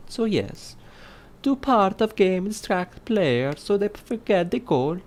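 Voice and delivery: high comic Italian reading voice; Italian accent. Acted. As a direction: keep the hammed-up Italian accent going. Keeps the Italian voice while reading.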